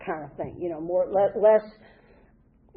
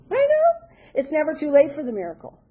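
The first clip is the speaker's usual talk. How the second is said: amazed; 5 semitones higher, 4 dB louder than usual. Real, not acted. Marvelling at mother's late miracle.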